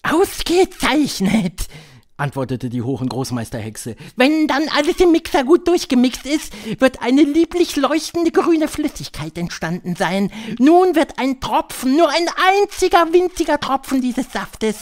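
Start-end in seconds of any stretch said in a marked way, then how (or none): 0.0-1.7 high, slow, drawn-out witch voice; high-pitched, deliberate witch lecturer; Grand High Witch. A narrator's reading. 4.2-14.8 high, loud witch voice; high-pitched, theatrical witch leader; Grand High Witch. A narrator's reading.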